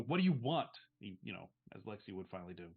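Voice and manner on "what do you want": pressing louder and higher; insistent. Shown as a demonstration. Demands an answer from the evasive visitor, insistent. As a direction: finally demand it. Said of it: "Finally, you go".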